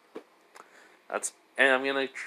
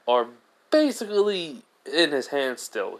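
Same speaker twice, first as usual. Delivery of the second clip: plain reading voice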